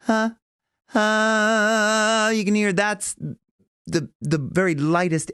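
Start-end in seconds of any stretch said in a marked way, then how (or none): 0.0-2.3 light onset huh. Shown as a demonstration. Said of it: "When they just touch".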